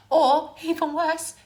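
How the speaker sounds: high, slow, loud; mock outrage; outraged emphasis. Real, not acted. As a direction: lean hard into the outrage.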